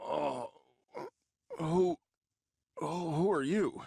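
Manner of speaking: stammering in fright; frightened stammer. Acted.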